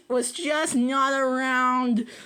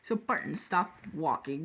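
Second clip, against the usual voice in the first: ordinary delivery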